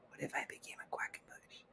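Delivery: hushed, voiceless musing; conspiratorial whisper. Pretend. Host wondering about taking the fan's surname, whispering. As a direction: whisper it like a secret wish. Confides a daft wish to rename herself.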